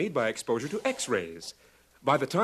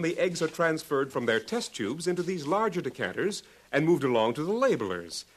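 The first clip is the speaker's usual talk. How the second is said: usual speaking voice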